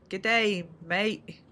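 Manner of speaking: slow, careful try at an Australian "good day, mate"; attempted Australian accent. Shown as a demonstration. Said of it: "I'm very bad at accents".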